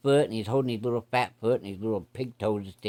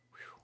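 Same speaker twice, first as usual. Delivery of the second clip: relieved whew; 25 dB quieter than usual. Real, not acted. Relief after the worm question.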